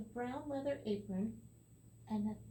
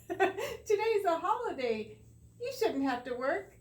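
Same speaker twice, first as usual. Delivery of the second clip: laughing redo; 9 semitones higher, 7 dB louder than usual. Acted. Reader retries Tom's line while laughing. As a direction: start over still giggling. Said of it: "that's not the right voice".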